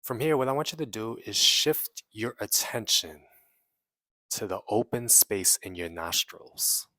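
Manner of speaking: measured meditation guide with long pauses; guided meditation. Real, not acted. Guiding listeners to release trapped emotions, in an unhurried meditation voice. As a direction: lead them inward phrase by phrase, leaving long silences.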